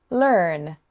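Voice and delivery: rhotic "learn" with the er sounded; a bit North American, "learn" with a clear R; rhotic accent. Shown as a demonstration. Teacher says "learn" for learners to hear the difference, rhotic accent. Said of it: "the second was with a rhotic accent".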